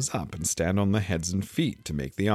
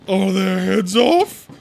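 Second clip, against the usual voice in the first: high, loud, drawn-out shout; shouted question; 14 semitones higher, 10 dB louder, slower than usual. A narrator's reading. Queen checks on her soldiers, shouting. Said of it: "shouted the Queen".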